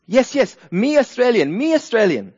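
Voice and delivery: high, loud, eager mock foreigner; hammed-up tourist insisting in broken English; foreign gentleman. Foreigner tries the citizens' queue at the airport, hammed-up insistence. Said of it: "this foreign gentleman rolls up and goes".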